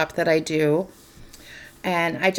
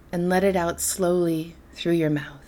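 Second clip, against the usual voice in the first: softer, lower-key meditation voice with long pauses; guided meditation; 3 semitones lower, 7 dB quieter than usual. Acted. Host leading listeners into darkness and quiet, guided meditation. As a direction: guide them gently into calm, leaving space between phrases.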